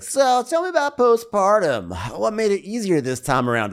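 mock Andy. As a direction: ask it with fake interest. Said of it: "So Andy's like".

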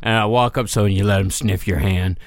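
ordinary talk, nothing marked

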